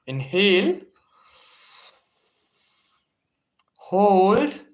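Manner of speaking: high, loud, drawn-out call; breathing cue. Real, not acted. Instructor cueing students to hold their breath, called out high. Calling breath cues in guided exercise.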